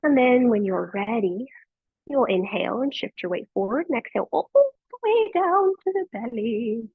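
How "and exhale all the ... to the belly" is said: high, lilting British accent on the exhale cue. Shown as a demonstration. Yoga teacher cueing the class down to the belly, in a put-on British accent. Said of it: "don't I sound British when I do that?".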